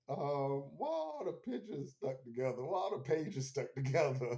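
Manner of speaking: put-on playfully naive girl voice; imitating Ellie. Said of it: "she's like".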